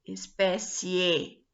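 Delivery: Espécie said slowly with the E stressed; slow syllable demo. Shown as a demonstration. Teacher repeating Espécie slowly for students. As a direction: stretch Espécie so the E stands out. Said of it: "Tá vendo?".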